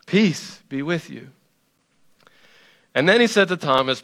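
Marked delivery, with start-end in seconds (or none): 0.1-1.3 quiet, gentle peace be with you; soft calm greeting. Retold or imitated. Jesus calms his frightened disciples, softly. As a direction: settle the room with it softly. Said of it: "And Jesus says".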